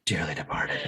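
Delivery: hushed funeral whisper, low and soft; mock solemn whisper. Solemn pun on band name.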